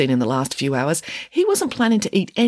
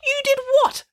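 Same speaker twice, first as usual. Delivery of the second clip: high-pitched outraged accusation; scandalised, voice leaping up; accusing. A narrator's reading. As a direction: demand it in shocked indignation. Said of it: "accused Judith Piggins".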